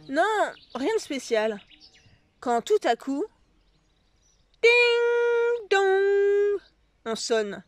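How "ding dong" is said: sung high ding dong like a bell; doorbell chime. A narrator's reading. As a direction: ring it out like a doorbell. Doorbell in the story. (sonnette chantée)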